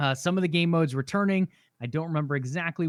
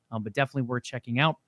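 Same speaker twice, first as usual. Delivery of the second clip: normal talking voice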